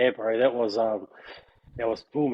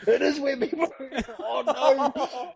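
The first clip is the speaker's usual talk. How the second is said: high-pitched retold dismay, laughing; mock horrified realisation; 10 semitones higher, slower than usual. Prankster realising it really is the coach, mock horror. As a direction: replay the panic, amused at yourself.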